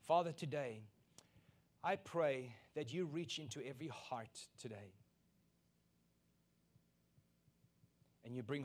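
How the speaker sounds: earnest pulpit prayer. Acted. Pastor praying over the congregation, earnest. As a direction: pray over the church, earnest and lifted.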